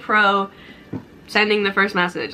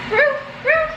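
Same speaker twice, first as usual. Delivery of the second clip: high little roo imitating a dog whine; dog howl imitation. Imitating her dog's whining cry for viewers.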